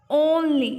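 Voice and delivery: "only" loud, with a full long oh; teacher modelling "only" as OHN-lee; long O sound. Shown as a demonstration. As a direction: stress a round OH at the start of "only". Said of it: "इसको only नहीं बोलेंगे".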